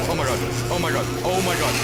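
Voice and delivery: panicking as they close in; panicked. Real, not acted. Mannequins closing in.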